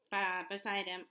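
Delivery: plain reading voice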